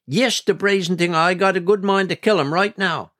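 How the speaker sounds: high-pitched, indignant grumbling threat; indignant anger. A narrator's reading. Grandmother condemning the goat to grandfather, indignant.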